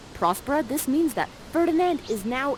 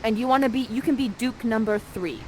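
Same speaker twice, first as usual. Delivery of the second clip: ordinary talk, nothing marked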